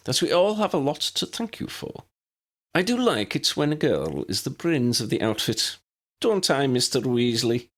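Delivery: high, prim Scottish headmistress voice; brisk, proper elderly professor; McGonagall. A narrator's reading.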